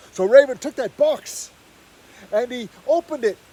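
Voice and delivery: loud, high dramatic narration; booming climax as daylight flashes; excited climax. A narrator's reading. Story climax.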